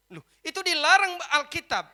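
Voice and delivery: very loud, high, impassioned sermon; full-force preaching. Acted. Preacher argues over blessing unmarried parents, shouting at full force. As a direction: shout it out to the congregation, pitched high.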